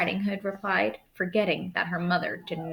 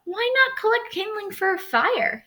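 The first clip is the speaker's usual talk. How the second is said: high, sugary wolf voice; sly wolf; 13 semitones higher than usual. Acted. Wolf lures the girl off the path, sugary. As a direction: tempt her off the path.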